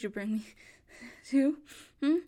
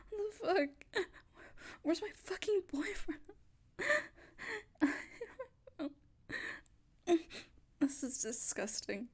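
near tears; 8 dB quieter than usual. Acted. Grabbed by strangers, near tears.

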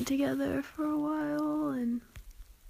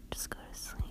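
sleepy whisper; 10 dB quieter, faster than usual. Acted. Lulling partner to sleep.

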